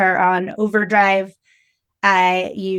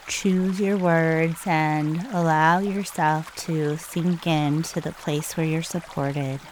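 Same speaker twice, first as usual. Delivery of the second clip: soft, hushed meditation guide with long pauses; calm, unhurried breathing instructions, spoken softly; guided meditation; 2 semitones lower, 8 dB quieter than usual. Real, not acted.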